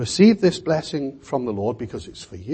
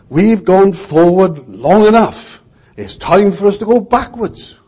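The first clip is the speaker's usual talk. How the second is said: mock politician; 6 semitones higher, 7 dB louder than usual. Preacher imagining a politician addressing the public, mock oratory. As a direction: send up a politician's rousing slogan. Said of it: "imagine a politician saying to us".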